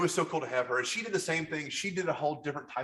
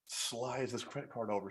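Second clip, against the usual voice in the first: ordinary talk, nothing marked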